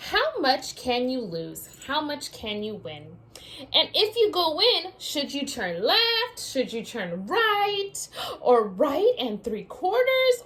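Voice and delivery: lively storybook read-aloud; reading to children. Acted. Teacher reading a picture book to her class, lively read-aloud. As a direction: read it out like story time for little ones.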